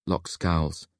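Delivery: ordinary delivery, nothing marked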